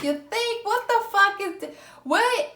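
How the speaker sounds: high, incredulous squeal; a stunned what-the-hell; sarcastic disbelief. Retold or imitated. Sarcastic reply, baffled.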